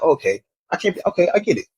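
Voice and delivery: normal talking voice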